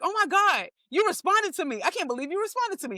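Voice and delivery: high, mock-starstruck squeal; gushing fan. Comedian mimicking a troll she answered, gushing. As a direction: imitate a troll thrilled to get a reply.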